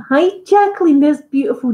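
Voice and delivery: high, loud delighted hi; excited greeting. Real, not acted. Greeting a favourite viewer in chat, high and delighted. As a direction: greet a friend you're thrilled to see.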